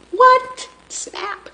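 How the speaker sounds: squeaky high mock surprise; high playful triumph. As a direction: pitch it way up, pleased with yourself. Playful triumph as phone stays up.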